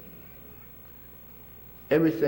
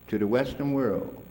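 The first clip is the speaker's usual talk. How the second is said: ordinary talk, nothing marked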